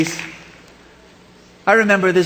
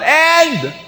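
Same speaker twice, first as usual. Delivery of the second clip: falling scream; 8 semitones higher than usual. Acted. Worker falls to his death.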